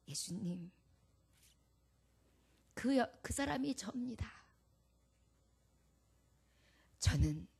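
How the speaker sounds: broken, whispered, ashamed confession; hushed confession. Acted. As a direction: whisper her shame with long pauses. Woman confesses to Jesus, whispered.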